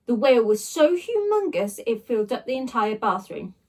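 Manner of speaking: ordinary delivery, nothing marked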